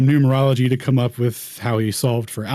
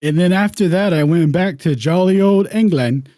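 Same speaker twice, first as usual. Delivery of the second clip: mock English accent; 7 semitones higher, 5 dB louder than usual. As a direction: stay in the jolly English gent voice. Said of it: "narrate in my best English accent".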